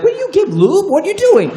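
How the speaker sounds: high, startled squeak; mock-scandalized whisper-shout at girlfriend; shocked. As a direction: squeal it out in disbelief. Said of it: "and I was like".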